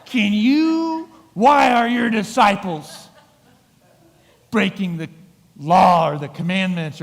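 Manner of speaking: a self-righteous accuser pouncing; accusing Pharisee. Retold or imitated. Pharisees confront the disciples.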